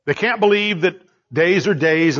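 ordinary talk, nothing marked

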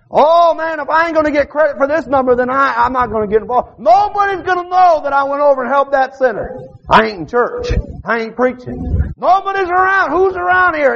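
high, hammed-up selfish voice; voicing a selfish man. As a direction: play the man dodging the sinner.

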